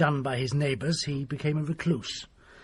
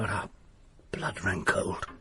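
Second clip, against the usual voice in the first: hushed whisper; 7 semitones lower, 5 dB quieter, slower than usual. Acted. Telling a horror story.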